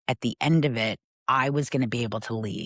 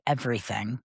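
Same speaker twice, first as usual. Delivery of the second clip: usual speaking voice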